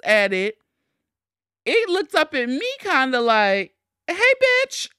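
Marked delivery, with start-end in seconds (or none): 4.1-4.9 high-pitched cheeky bird voice; mock bird voice, pitched way up; sassy bird. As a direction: greet them like a cocky little bird. Voices the bird looking at her.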